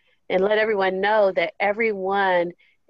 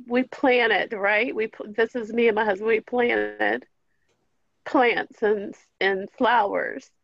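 ordinary delivery

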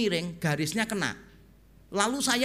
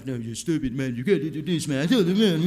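imitated angry rant; 6 semitones lower than usual. Attendant scolding the confused preacher, imitated angry rant. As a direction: rattle off a mimicked irritated rap of English. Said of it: "Ngomongnya terlalu cepet dengan aksennya dia. Waktu marah".

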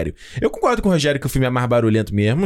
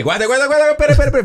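urgent interruption; 10 semitones higher, 5 dB louder, faster than usual. Real, not acted. Cuts in to stop the talk.

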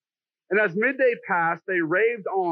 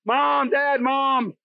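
put-on kid calling mom; 6 semitones higher, much slower than usual. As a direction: pester like a kid wanting mom. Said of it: "your kids going".

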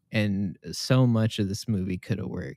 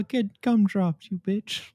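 high slow cartoon taunt; 11 semitones higher, slower than usual. Repeats taunt in voice.

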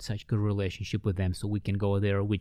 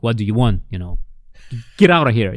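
loud, brusque get out of here; a woman shouting at strangers at her door; angry retort; 8 dB louder than usual. Retold or imitated. An annoyed woman at her door tells the visitors to leave, loud and gruff.

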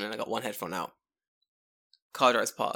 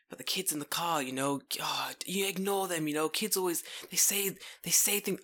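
put-on hushed, airy voice of a concerned old woman; lightly voiced old woman, half whispering; 5 semitones higher, 4 dB quieter than usual. Old woman consoling a passer-by, hushed and concerned. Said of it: "an old woman goes to me".

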